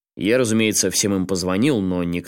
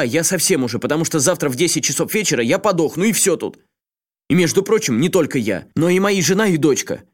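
high, agitated desperate man; pleading in rising panic; взволнованный Асакава; 6 semitones higher, 3 dB louder than usual. A narrator's reading. Асакава в отчаянии кричит другу. (взволнованный, отчаянный голос)

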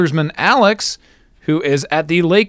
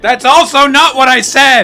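exasperated yell; 8 semitones higher, 4 dB louder than usual. Acted. Frustrated correction of co-host.

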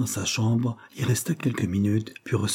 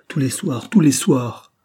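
higher-pitched repeated demand, building louder; insistent demand; 5 semitones higher, 6 dB louder, slower than usual. A narrator's reading. Furious governess questioning Cyril about Herbert, insistent and rising in force. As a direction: press harder with each repetition.

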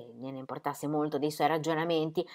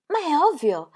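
higher, louder eager comeback; quick retort; 10 semitones higher, 6 dB louder than usual. Acted. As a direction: shoot back as if it's obvious. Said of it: "ribatté". (Ingeborg ribatte, più acuta e forte)

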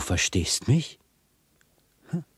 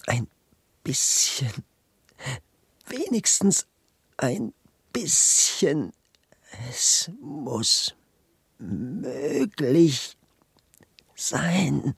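straining effort; 5 semitones higher, much slower than usual. Acted. Statue straining to move arm.

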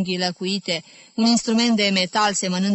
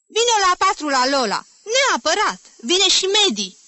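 eager invitation; 11 semitones higher, 5 dB louder than usual. A narrator's reading. Dora eagerly inviting Jim.

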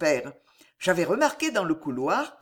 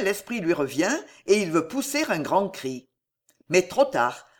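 usual speaking voice

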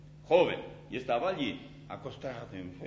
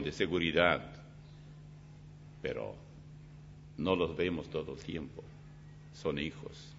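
pastoral prayer; 8 semitones lower than usual. Acted. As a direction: pray over the young people gently. Closing prayer.

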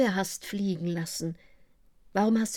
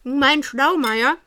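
teasing spouse; 3 semitones higher, 5 dB louder than usual. Acted.

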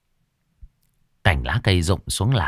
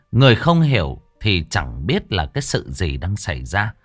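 usual speaking voice

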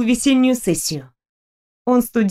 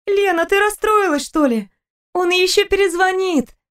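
put-on higher, lively young woman; Stella's voice; 9 semitones higher than usual. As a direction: chatter happily about a trip. Stella comforts.

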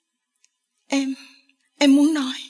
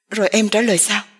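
fearful question; faster than usual. A narrator's reading. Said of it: "Lành hỏi với đầy vẻ sợ hãi".

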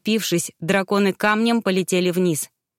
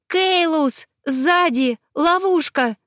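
крик; 9 semitones higher, much slower than usual. A narrator's reading. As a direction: shout a warning to him. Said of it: "крикнула она". (крик девушки, предупреждающей о ловушке)

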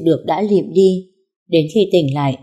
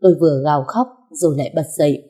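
usual speaking voice

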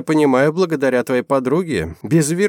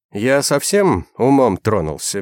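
usual speaking voice

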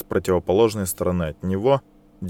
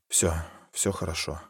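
soft, hushed reassurance; calm them gently; reassuring; 4 semitones lower, 10 dB quieter than usual. Acted. Husband embraces and calms family.

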